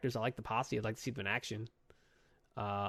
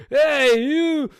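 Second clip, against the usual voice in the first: much higher, louder mock holler; 12 semitones higher, 9 dB louder than usual. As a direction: yell at her like an angry truck owner. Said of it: "he comes out and he's like".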